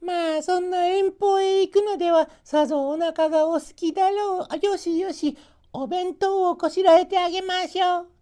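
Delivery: put-on high, fussing old woman voice; a doting grandma offering lunch; high caring granny voice; grandmother. A narrator's reading. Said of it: "とおばあさんも言いました".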